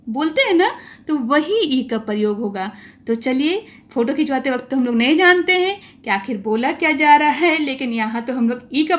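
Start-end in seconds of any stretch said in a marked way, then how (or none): none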